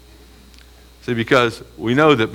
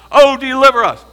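loud, higher plea; crying out; 12 semitones higher, 6 dB louder than usual. Acted. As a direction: beg for deliverance aloud. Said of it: "How should they be crying out".

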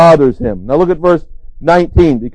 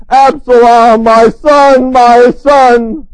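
louder, higher, anguished calling; grieving father; 5 semitones higher, 5 dB louder, much slower than usual. Retold or imitated. David calling to dead Absalom, grieving. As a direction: mourn a dead son aloud. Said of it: "David says to Absalom after he dies".

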